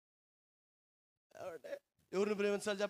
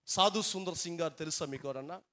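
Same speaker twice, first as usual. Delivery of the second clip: ordinary talk, nothing marked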